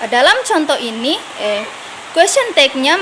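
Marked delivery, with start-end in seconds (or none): none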